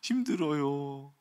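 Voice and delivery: lightly voiced crying child; mock weary whine. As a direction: drag it out like an exhausted kid. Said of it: "막 힘들어서 막 울어요".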